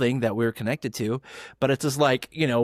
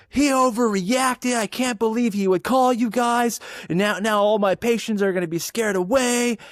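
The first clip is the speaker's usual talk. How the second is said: hammed-up outraged owner, pitched way up; mock boss voice; 11 semitones higher, 4 dB louder than usual. Mimicking the absent owner on speakerphone, high whiny mock voice. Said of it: "She goes".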